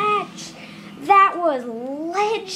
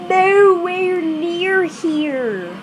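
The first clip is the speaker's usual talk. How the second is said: drawn out; 4 dB louder, much slower than usual. Real, not acted. Repeats phrase drawn out.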